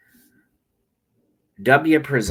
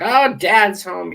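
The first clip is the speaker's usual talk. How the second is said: put-on high, slow, louder whiny child; mock whining kid; 9 semitones higher, 5 dB louder, slower than usual. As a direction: mimic a scared kid dreading dad. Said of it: "the kids are crying, and it's like".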